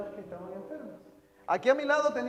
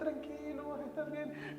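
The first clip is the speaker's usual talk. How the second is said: soothing; 5 semitones higher, 6 dB quieter, slower than usual. Retold or imitated. Sister soothes dying brother.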